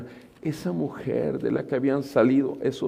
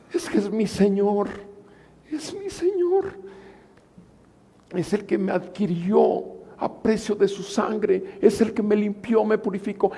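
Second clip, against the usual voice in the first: higher and louder, passionate; fervent Mary; 7 semitones higher, 4 dB louder than usual. Acted. Mary longing for her Lord, higher passionate outcry. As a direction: pour out her devotion. Voicing Mary's devotion.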